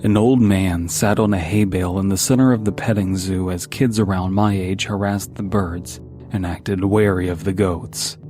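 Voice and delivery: usual speaking voice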